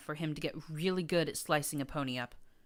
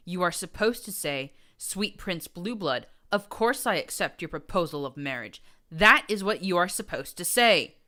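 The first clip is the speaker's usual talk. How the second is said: louder, hissed false sweetness; hissing geniality; 3 semitones higher, 8 dB louder than usual. A narrator's reading. Ghoul prince hissing at the narrator, mock genial. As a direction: hiss it with horrid charm. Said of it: "he hissed ... hissing voice of horrid geniality".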